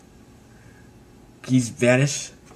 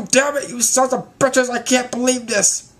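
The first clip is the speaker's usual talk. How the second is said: high-pitched mock outrage; outraged villain; 11 semitones higher than usual. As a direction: curse them in squealing frustration. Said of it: "He's like".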